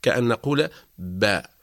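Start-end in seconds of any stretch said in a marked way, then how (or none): 1.0-1.5 "باء" as an open "ba"; clear fatha. Shown as a demonstration. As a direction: part the lips fully into the a of "باء". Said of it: "نحقق الفتحة بانفتاح وانفراج". (نطق "باء" بفتحة محققة)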